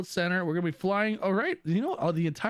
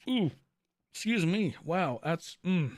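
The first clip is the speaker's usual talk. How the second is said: heartburn catching the voice; talking through heartburn. Real, not acted. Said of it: "good old heartburn".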